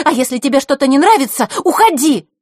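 very loud, high shout; furious shout. Acted. Scene: Zakhara shouting her guest out of the room. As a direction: order her out at full voice.

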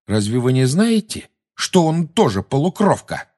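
provoking question, pitched high; defiant, cheeky. A narrator's reading. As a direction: throw it back boldly. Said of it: "дерзко спросил Гарри".

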